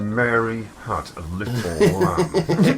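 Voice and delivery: slow, menacing, jaw clenched; nursery rhyme made to sound menacing; through gritted teeth. Shown as a demonstration. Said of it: "Anything you say through gritted teeth just sounds menacing".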